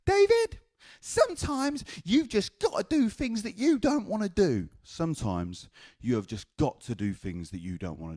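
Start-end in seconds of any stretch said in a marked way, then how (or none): 0.0-0.6 very high, loud grandma screech; grandma's yell. Retold or imitated. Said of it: "it was like this". 1.0-4.7 very high mock grandma screech; grandma's lecture. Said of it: "it was like this".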